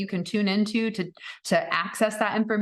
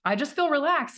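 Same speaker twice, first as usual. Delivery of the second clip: high uncertain mock voice; unsure after the session; doubtful self; 8 semitones higher than usual. Her young self to husband, high doubtful. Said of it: "I said".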